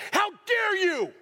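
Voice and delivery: high, slow and stretched; slow, outraged cry at the deacons. Pretend. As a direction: drag out each word in disbelief. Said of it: "knocks those trays out of their hands and goes".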